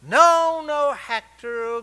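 high, loud, drawn-out refusal; booming God. Retold or imitated. God correcting Hector's wish for a richer father, loud refusal. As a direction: overrule him from on high, stretching it out. God overruling him, acted.

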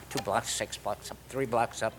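low, soft repeating under the breath; repeating directions to himself. Retold or imitated. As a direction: quietly try to memorize the route. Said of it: "And I'm going".

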